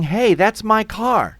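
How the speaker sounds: high, loud, indignant protest; alarmed shouting. Acted. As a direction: call out in outraged alarm. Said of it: "They're shouting".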